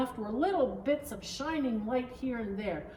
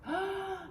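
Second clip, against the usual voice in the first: a quick sharp gasp, higher; gasp at brightness; 8 semitones higher, 4 dB quieter than usual. A narrator's reading. Narrator gasps as light splashes, sharp gasp. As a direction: catch your breath at the light.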